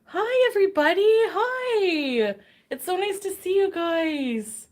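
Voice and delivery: bright, high hello to the chat; excited greeting. Real, not acted. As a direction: greet everyone with a lift. Streamer greeting her viewers.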